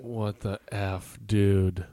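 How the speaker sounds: low, slow, hushed amazement; stunned disbelief. Real, not acted. Co-host floored by the storyteller's answer, stunned. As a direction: drag it out softly, really floored.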